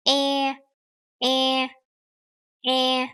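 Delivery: EH narrowed toward "ay", mouth stretched; closer mouth. Shown as a demonstration. Teacher contrasts this letter, EH with closer stretched mouth. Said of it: "your mouth is kind of, like, closer".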